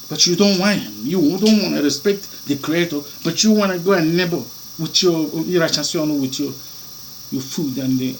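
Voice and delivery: ordinary talk, nothing marked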